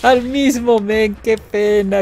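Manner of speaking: high, gloating fake sympathy between laughs; mock pity. Real, not acted. Player apologizing to a victim, gleeful mock pity. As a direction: draw out the fake sorrow, close to laughing.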